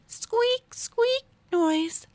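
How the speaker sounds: high squeaky mouse sound; mouse squeak. Acted.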